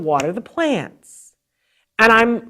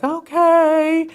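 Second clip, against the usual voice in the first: high, drawn-out "okay"; dubious agreement; 9 semitones higher, 3 dB louder than usual. Retold or imitated. Mother answering the therapist's odd instructions, dubious. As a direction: agree uncertainly, stretching it out. Retold wary reply to therapist.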